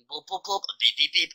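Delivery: spoken computer boops and beeps; imitated notification beeps. Shown as a demonstration. Mocking Windows ten to other players, imitated notification beeps.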